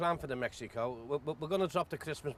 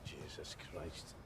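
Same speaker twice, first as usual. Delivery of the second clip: exasperated groan; 11 semitones lower, 13 dB quieter, much slower than usual. Acted. Balls missing, manager despairs.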